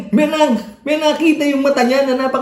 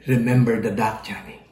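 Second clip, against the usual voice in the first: whisper; 10 semitones lower, 6 dB quieter than usual. A narrator's reading. Sally's repeated whispered threat.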